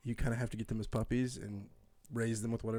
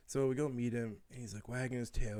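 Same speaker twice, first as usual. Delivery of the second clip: ordinary talk, nothing marked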